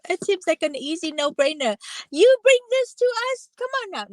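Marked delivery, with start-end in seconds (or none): 2.1-4.1 much higher, louder teasing mock rebuke; hammed-up sassy scolding. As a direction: pretend to scold her playfully. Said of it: "No, I'm just kidding".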